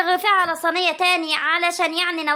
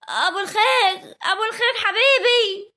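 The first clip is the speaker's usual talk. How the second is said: tearful joy; 5 semitones higher than usual. Acted. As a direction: cry the name out, drawn out and higher. Tearful reunion with long-absent brother.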